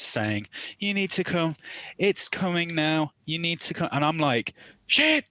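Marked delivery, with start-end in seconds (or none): 0.8-3.9 higher-pitched urgent plea; retold distress. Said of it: "obvious distress". 4.9-5.2 loud, very high exclamation; mock panic. Retold panic on the phone news.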